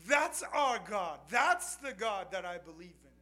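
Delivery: loud, high, slow proclamation; preaching shout. Real, not acted. Preacher proclaiming God to the congregation, shouting. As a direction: proclaim it to the room.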